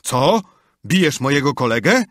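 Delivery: high, loud outraged yell. A narrator's reading. Potter yells at the doctor hitting his mate, high and loud. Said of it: "i wrzasnął".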